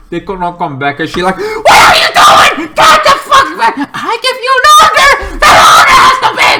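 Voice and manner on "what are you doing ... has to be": screaming, very high and loud, with a cracking laugh in it; furious shouted orders. Retold or imitated. Amanda Waller yelling at the team to come back, screaming orders. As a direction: bellow at the squad who won't obey.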